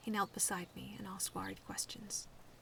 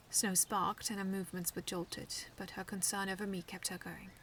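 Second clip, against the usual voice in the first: usual speaking voice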